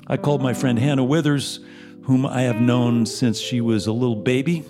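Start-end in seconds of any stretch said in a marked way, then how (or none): none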